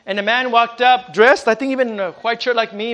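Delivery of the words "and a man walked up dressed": ordinary talk, nothing marked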